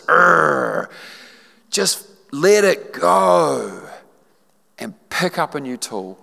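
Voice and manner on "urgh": a short mock frustrated groan; imitating an agitated grunt. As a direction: groan like you're fed up.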